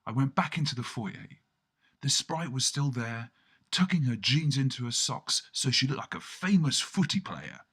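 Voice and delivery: ordinary delivery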